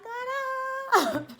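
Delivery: playful high-pitched "what up"; high squeaky greeting. Retold or imitated. Her own playful greeting.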